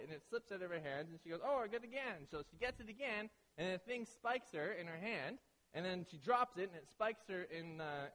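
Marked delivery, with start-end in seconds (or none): 1.4-2.3 excited catch. Retold or imitated. Acting girlfriend grabbing fish again.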